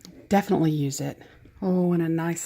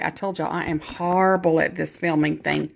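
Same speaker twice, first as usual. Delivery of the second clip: ordinary delivery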